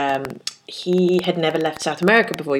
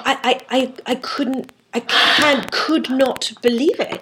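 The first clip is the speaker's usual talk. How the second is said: stammering, higher pitched disbelief; shocked Fernando; 6 semitones higher, slower than usual. Retold or imitated. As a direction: stutter out his outrage, pitched up.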